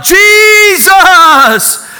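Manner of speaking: a high, loud shout of Jesus; a preacher reliving how he yelled to God for rescue; yelled name. Retold or imitated. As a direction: cry the name out with everything. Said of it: "And I yelled out".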